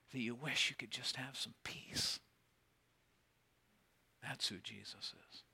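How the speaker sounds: hushed whisper, voice dropped right down. Real, not acted. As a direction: lean in and whisper it like a secret. Intimate moment in sermon.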